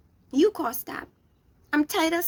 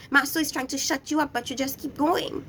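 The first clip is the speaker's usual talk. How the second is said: usual speaking voice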